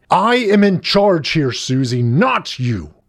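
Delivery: loud assertion of command; authoritarian shout. A narrator's reading. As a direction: shout it high, then come down hard. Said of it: "Mister Smith shouted".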